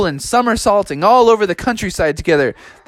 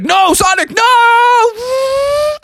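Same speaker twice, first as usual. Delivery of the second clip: high shouted panic; long wailing cartoon "no"; terrified protest; 3 dB louder, much slower than usual. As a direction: scream it as if pushed down a hill. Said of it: "And Froggy was like".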